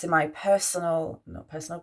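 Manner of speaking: ordinary talk, nothing marked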